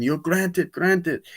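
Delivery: high, exclaimed imitation; mock wish-granting. Villain granting everything, exclaiming.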